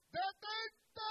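sudden high shouted stop; God's command. Acted. God stopping Abraham mid-sacrifice, high loud shout. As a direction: halt him with one loud cry.

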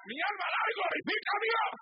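loud, high, fervent preaching; preaching at full force. Acted. Preacher exhorting the congregation, loud and fervent. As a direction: proclaim it with full fire.

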